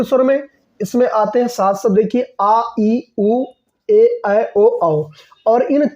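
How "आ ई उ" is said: ordinary talk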